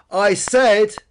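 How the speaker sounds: loud, high, emphatic; shouting into an ear. A narrator's reading. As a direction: yell it at a deaf listener. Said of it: "shouted into Peter's ear".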